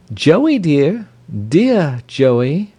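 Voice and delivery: high cooing to a pet; high, sing-song endearment; fond pet talk. A narrator's reading. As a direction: coo affectionately at a bird. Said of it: "this to the peacock".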